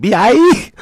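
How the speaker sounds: high, loud mock yell; shouted echo. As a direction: holler the letters high, as a joke. Loudly echoing the album name, laughter.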